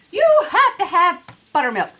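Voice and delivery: buttermilk stressed hard and loud; insisting. Real, not acted. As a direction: lean into it, you really must have it.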